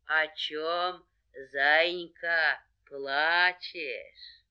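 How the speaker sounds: slow, slightly low bear voice. Acted. Bear asks the hare about tears, ponderous. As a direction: ask ponderously, stretching each word.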